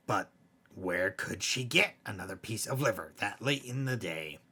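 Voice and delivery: plain reading voice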